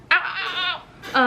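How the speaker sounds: high, laughing ow-ow-ow; high protest at a pet; pained yelps. Real, not acted. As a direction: yelp a string of high ows. Cat is bothering her.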